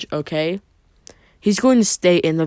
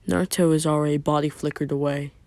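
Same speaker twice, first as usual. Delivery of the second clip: ordinary delivery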